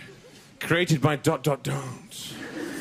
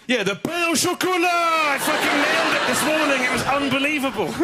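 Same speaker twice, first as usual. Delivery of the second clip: laughing, much higher triumphant shout; gleeful high yell of victory; 7 semitones higher than usual. Real, not acted. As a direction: crow about nailing it. Triumphant about ordering the pastry.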